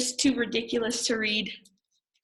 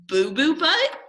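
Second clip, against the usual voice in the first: incredulous; 7 semitones higher, 3 dB louder than usual. Acted. Reader incredulous at the name.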